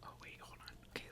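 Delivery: usual speaking voice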